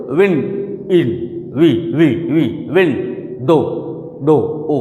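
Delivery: विंडो split into विन and दो, each isolated; syllable breakdown. Shown as a demonstration. Teacher showing how to find two syllables, sounded out in pieces. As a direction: repeat each piece of विंडो alone, stretching out the vowel. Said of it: "दो syllable कैसे पता करें?".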